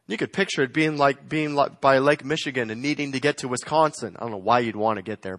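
usual speaking voice

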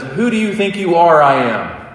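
imitating a champion's triumphant yell; retold yell. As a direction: shout it like a bowler who just won. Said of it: "He, like, yells it".

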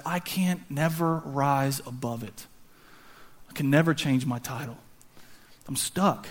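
low, soft and heavy with pauses; quiet acted despair; hopeless resignation. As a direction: let it sink, defeated. Voicing a shepherd resigned to his lot.

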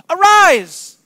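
loud, high, falling order; stunned captain barking arise; incredulous command. Acted. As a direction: demand in disbelief.

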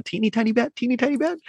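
high, tiny squeak of a bat; high singsong teeny bat voice; squeaky bat voice. Retold or imitated. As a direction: squeak it out like a little cartoon bat. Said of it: "I just kinda start doing, like, the".

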